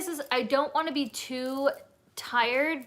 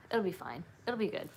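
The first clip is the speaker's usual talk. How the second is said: ordinary talk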